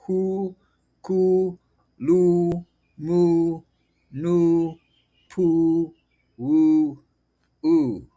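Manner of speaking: "hu, ku, lu, mu, nu, pu, wu, u" with long "oo", set apart; syllable demonstration. Shown as a demonstration. Teacher showing the vowel U, drawn-out separate syllables. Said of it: "would be pronounced U".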